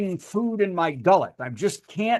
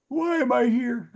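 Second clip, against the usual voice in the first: higher, put-on confused voice; mock bewildered guest; 5 semitones higher than usual. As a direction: play a guest who just woke up confused. Voices sleepy Mark rubbing his eyes.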